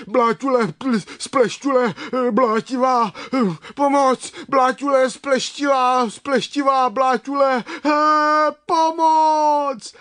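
high, loud babbling screams; panicked shouting. A narrator's reading. Brunda flees the muddy monster, yelling for help. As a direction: shriek the garbled names in panic, building to a long cry. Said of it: "křičel Brunda".

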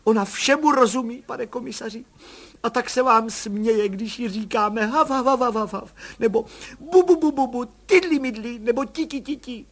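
put-on high, sing-song baby talk with barking noises; mother showing how she babbles to her baby. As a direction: coo and play like to an infant.

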